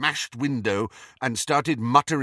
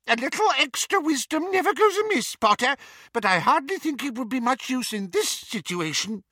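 high squeaky professor voice; squeaky little wizard; 14 semitones higher, 4 dB louder than usual. A narrator's reading. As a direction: keep the high piping squeak. Flitwick voiced by narrator.

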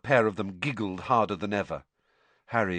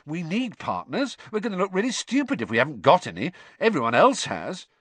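higher, impatient pleading; impatiently; 9 semitones higher, 4 dB louder than usual. Acted. Ron pressing Hermione to be a partner, impatiently. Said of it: "he said impatiently".

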